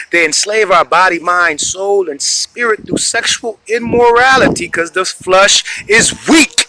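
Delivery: loud, high preaching climax; fiery preaching. Real, not acted. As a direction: build it up like a street preacher.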